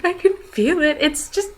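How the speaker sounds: mock paranoid whine. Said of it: "And I'm like".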